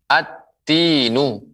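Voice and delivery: slow "at-tinu", doubled t, long vowel; merged article. Shown as a demonstration. Quran teacher gives an example of letter ta, merged article. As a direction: hold the t and stretch "at-tinu".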